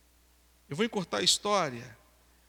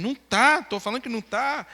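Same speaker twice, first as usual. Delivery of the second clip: loud, raised retold protest; insistent denial; 3 semitones higher, 5 dB louder than usual. Frightened boy arguing with crying girl, insistent denial. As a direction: deny it forcefully, voice jumping up. Acted back-and-forth argument, panicked denial.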